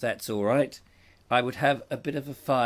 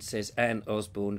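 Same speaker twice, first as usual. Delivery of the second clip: ordinary talk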